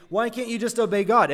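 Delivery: mock outrage at the Bible's failures; exasperated. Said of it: "read the stories about the failures and think".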